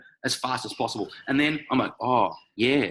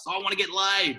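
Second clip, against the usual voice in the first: higher mocking lad voice; mock bloke; 7 semitones higher than usual. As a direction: squeak it out like a clueless guy. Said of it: "these guys who are like".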